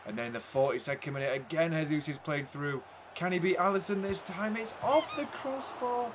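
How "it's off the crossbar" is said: high, slowed cry as it hits the bar; groan-call the near miss, drawing it out; high drawn-out near-miss call; excited. Real, not acted. Near miss in his game.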